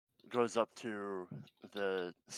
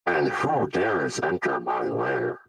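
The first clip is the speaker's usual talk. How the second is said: low, slow menacing villain voice; hissing reptile growl; 6 semitones lower, 12 dB louder, slower than usual. Acted. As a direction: growl it like a lurking boss. GM voices villain over speaker.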